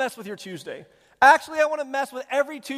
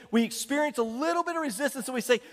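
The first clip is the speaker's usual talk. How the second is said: ordinary delivery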